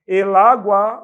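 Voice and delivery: "El agua" said loud; loud example. Shown as a demonstration. Teacher announcing the example, loud. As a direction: punch "El" in "El agua". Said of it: "a gente não fala la agua".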